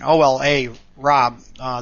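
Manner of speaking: ordinary talk, nothing marked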